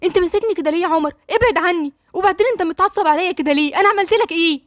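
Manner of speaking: acted hurt, close to tears; pained, starting to cry. A narrator's reading. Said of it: "بصت له بألم وقالت له".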